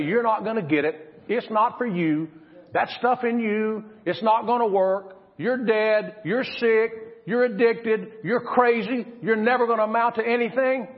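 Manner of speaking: mock the doubters' verdict; scornful naysayers. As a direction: doubters telling you you'll never amount to anything. Naysayers voiced by preacher.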